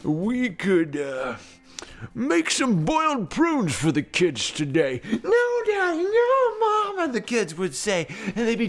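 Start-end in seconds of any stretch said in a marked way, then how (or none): 0.0-5.0 slow, swooping dad voice; leisurely, singsong musing; hungry folksy papa. Acted. Narrator plays Papa Slooverton thinking aloud. 5.1-7.1 put-on high-pitched childish pleading; high little voice begging Mom and Dad; protesting kids. Kids protest boiled prunes.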